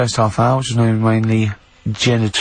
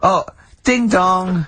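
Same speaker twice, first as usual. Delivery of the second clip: comic character; 6 semitones higher, much slower than usual. Pretend. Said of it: "Holy Fuck, yeah".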